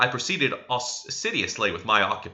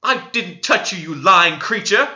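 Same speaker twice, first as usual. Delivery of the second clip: angry cry; 7 semitones higher, 7 dB louder than usual. A narrator's reading. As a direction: spit out the insult in a rage. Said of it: "cried she".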